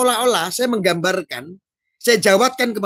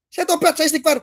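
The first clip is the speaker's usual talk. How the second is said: ordinary delivery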